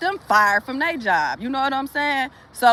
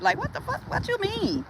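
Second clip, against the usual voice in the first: much higher, softer, disbelieving; incredulous; 9 semitones higher, 9 dB quieter than usual. Real, not acted. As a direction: squeak it out in disbelief. Incredulous outburst.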